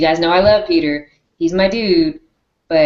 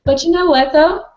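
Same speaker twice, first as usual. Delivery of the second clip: excited; 11 semitones higher, 4 dB louder than usual. Real, not acted. Excited aside, high pitch.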